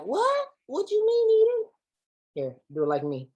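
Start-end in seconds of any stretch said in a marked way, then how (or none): 0.0-1.7 very high squeaky mock question; mock confused audience. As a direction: squeak it up like a puzzled newcomer. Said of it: "You're like".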